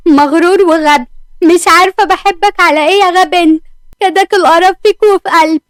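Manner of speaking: high, loud exasperated rant at him; angry outburst. Acted. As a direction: vent it, high and loud, hurt and furious. She rages after he leaves.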